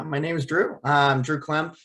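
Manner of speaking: ordinary talk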